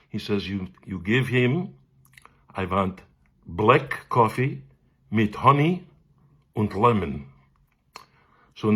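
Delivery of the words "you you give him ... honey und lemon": slow, deliberate Viennese pronouncement; Viennese accent. Retold or imitated. Teacher prescribing coffee for the pupil, slow Viennese accent. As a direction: spell out an order in a Viennese accent. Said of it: "He was my Viennese cantorial teacher".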